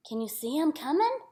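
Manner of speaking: ordinary delivery, nothing marked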